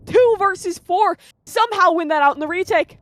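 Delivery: fast, rising play-by-play call; esports caster. Acted. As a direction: build the hype through the round. Live play-by-play casting.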